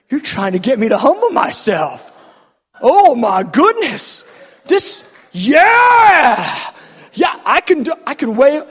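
building from loud realization to high shouting; dawning elation. Retold or imitated. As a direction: erupt into overjoyed yelling. Realization builds to acted joy.

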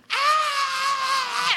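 imitated scream. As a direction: hold a high scream at restrained volume. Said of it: "they're screaming".

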